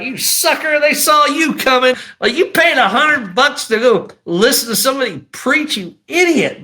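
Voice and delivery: high, scornful self-scolding; mad at himself. Retold or imitated. Man scolding himself over a hundred-dollar ticket, angry. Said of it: "I was so mad at myself".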